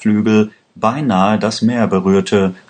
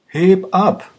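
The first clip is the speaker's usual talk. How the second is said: much higher, urgent repeated yell; frantic shout; 11 semitones higher than usual. A narrator's reading. Aladdin screaming at the pilot as police chase them, high frantic yell. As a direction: scream it as police cars close in. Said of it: "schrie Aladdin".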